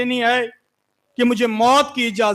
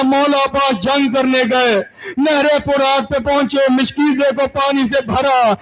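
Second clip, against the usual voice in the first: high, loud lamenting cry; climactic wailing recital; 6 semitones higher, 4 dB louder than usual. Acted. Preacher crying out Abbas's martyrdom, full wailing. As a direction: wail the death scene to the mourners.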